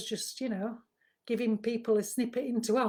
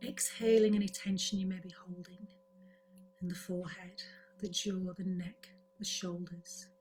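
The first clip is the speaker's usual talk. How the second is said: guided meditation; 4 dB quieter than usual. Acted. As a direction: ease listeners gently into calm, softly and unhurriedly. Leads a breathing relaxation.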